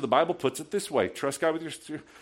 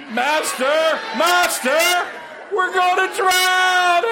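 much higher, loud panicked shout; yelling; 8 dB louder, much slower than usual. Shown as a demonstration. Pastor shows how disciples yelled in the storm, frantic shout. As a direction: yell it like the boat is sinking. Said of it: "They gotta be yelling it".

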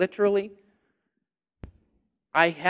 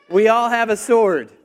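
loud preaching; 7 dB louder than usual. Real, not acted. Preacher reminds congregation of their sword, proclaiming loudly. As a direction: belt it out to the whole room. Resumes full-force preaching.

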